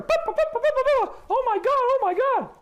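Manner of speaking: very high, loud panicky bleating; Beaker. Retold or imitated. Mocking hysterical critics as a panicked Beaker. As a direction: flap and bleat like a panicked Muppet. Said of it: "like Beaker".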